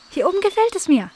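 carefree little kitten; kitten Cleo. A narrator's reading. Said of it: "meinte sie".

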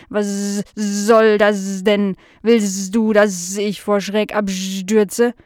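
slow, buzzing fly complaint; unfriendly housefly. Acted. Startled fly calling back at the barking dog, unfriendly and slow. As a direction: drag the words out in affronted buzzing. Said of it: "dieses Mal war es nicht freundlich".

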